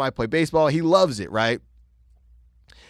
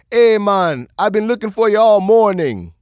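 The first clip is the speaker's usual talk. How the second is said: hearty holler of a man greeting a pal; louder, higher, imitating an eager acquaintance; Big Boy; 7 semitones higher, 6 dB louder than usual. Big Boy spots the narrator at the bar, imitated loud greeting.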